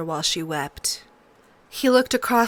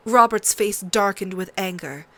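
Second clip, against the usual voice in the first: ordinary delivery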